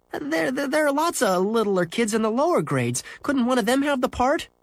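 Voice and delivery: high, stammering plea; desperate. Acted. Boy pleading to hand off his part, desperate. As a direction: grope for a way out, pitched high. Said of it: "he said desperately".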